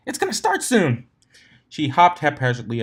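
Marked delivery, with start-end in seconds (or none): 0.1-1.1 very high, loud squeal; excited squeal. Acted. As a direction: squeal it, bouncing with glee. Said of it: "squealed her pink friend".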